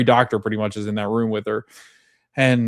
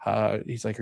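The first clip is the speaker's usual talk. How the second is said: usual speaking voice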